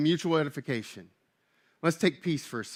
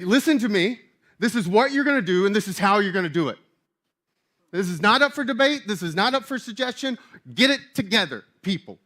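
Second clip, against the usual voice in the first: raised, emphatic stern mom; 3 semitones higher, 4 dB louder than usual. Acted. Preacher voicing Paul telling the church off, stern mom voice. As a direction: lay down the law like a fed-up mom. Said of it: "doing the mom voice".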